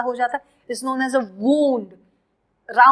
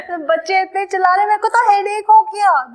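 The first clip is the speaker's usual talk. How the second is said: irritated complaint; 8 semitones higher, 4 dB louder, faster than usual. Pretend. Acted complaint, corrected version.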